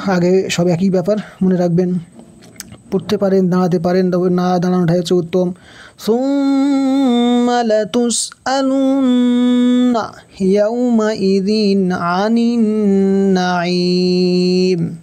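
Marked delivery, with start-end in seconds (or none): 6.0-15.0 slow sung Quran recitation; Quranic recitation. Shown as a demonstration. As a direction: draw out each word in recitation. Teacher chants Quran verse.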